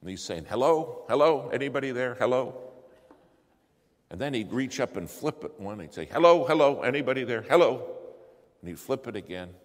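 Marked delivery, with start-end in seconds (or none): 0.5-2.6 loud, raised radio call for help; urgent calling out. Retold or imitated. Lawyer on the mic seeking help, urgent calling out. 6.1-8.0 very loud, high radio hail; insistent calling out. Retold or imitated. Lawyer trying another radio channel, insistent loud calling.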